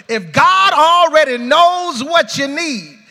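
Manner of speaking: loud, high shouted preaching; full-force preaching. Real, not acted. Pastor exhorting the congregation to pray honestly, shouting.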